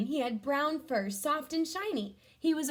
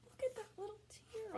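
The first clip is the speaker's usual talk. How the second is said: whisper; 10 semitones higher, 10 dB quieter than usual. Acted. Whispering over a sad picture.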